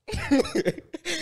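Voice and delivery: quick high rising laugh. Real, not acted. Cohost laughs at his own emotional ass, high laugh. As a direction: laugh at yourself.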